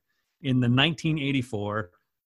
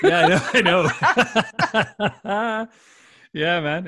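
high, loud laughing agreement; 8 semitones higher, 7 dB louder, much slower than usual. Real, not acted. Friend jokes he'd be catfishing, laughing along.

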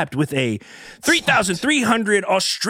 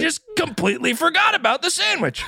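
higher, amused re-quote of the grandmother; mock-incredulous quote; 9 semitones higher than usual. Host milking a grandmother's excuse for laughs, mock-incredulous. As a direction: play up her confession with laughter in it.

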